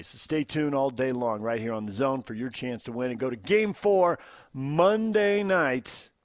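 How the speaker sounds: loud, high, punchy giveaway read; hyped radio promo. Acted. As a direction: sell the contest to listeners.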